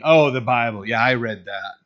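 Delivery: mock offhand brush-off, punched at the start. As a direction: imitate a dismissive scoffer, loud at first. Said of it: "they'll say mockingly".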